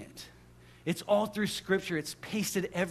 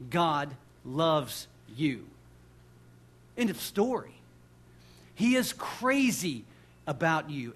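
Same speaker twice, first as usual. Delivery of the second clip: ordinary talk, nothing marked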